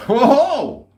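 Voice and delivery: high, loud burst of surprise; real high-pitched loud exclamation; startled whoa. Real, not acted. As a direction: let out a big whooping "whoa". Reacts to what is in cupboard.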